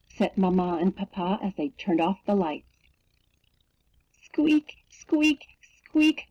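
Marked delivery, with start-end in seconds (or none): 4.2-6.3 high bed squeaks; squeaking. A narrator's reading. Narrator imitates the bed after lights out, high squeaks. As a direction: squeak high like old bedsprings. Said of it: "went the bed even louder".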